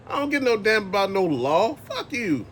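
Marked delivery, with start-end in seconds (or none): none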